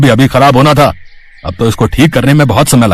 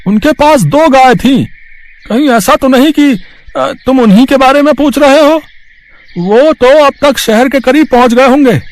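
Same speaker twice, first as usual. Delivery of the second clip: much higher, strained voice of a village cart driver; 4 dB louder than usual. Acted. Cart driver telling Mahesh about the truck, in a much higher strained voice. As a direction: pitch it way up as the old cart man. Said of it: "एक बैलगाड़ी वाला दिखाई देता है".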